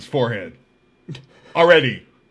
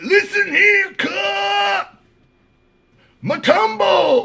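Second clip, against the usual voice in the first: high, loud gang-boss shout, imitated; mock tier call; 4 dB louder, much slower than usual. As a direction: holler down the whole cell tier. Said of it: "He would get on the tier and be like".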